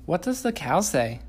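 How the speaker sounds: high, loud sing-song parent voice. Real, not acted. Parent quizzing a toddler.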